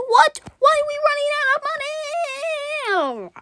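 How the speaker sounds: shocked. Real, not acted. Gamer shocked at the money running out. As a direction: sound stunned the money's gone.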